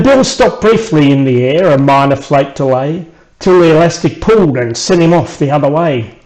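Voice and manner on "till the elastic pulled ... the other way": plain reading voice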